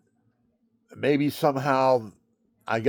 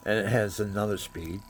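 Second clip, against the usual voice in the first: ordinary delivery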